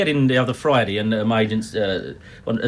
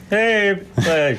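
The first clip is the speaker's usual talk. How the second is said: Jack Douglas; 5 semitones higher than usual. Retold or imitated. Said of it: "as forever played by Jack Douglas".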